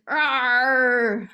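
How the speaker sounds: loud playful monster roar. Shown as a demonstration. Therapist showing kids a monster sound, loud roar. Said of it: "It might sound like this".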